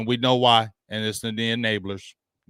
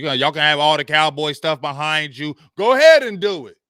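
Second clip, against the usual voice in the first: rising taunt; 8 dB louder than usual. Real, not acted. Host daring Cowboys fans on the stream, loud taunt. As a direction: go ahead, dare them louder and louder.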